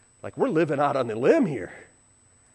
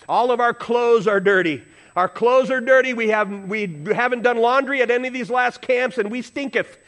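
wife complaining; 6 semitones higher, 4 dB louder than usual. Retold or imitated. Retelling wife's complaint about laundry.

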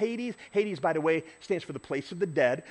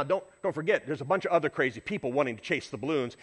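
normal talking voice

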